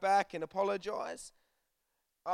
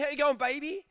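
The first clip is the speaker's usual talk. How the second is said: higher, cooing pet-name greeting; mock romantic greeting; sweet talk to wife; 6 semitones higher than usual. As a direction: coo it like to a lover. Mistaking mum for wife.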